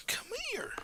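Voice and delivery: high, soft breathy coax; whisper-squeak for the puppy; high hushed come here; high whispered call. Real, not acted. Coaxing the dog.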